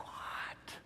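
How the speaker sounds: hushed incredulous what; mock shock under breath; stunned whisper; whispered disbelief. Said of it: "And I was just like".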